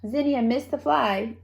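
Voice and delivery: usual speaking voice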